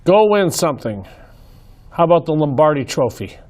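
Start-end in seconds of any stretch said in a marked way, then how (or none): none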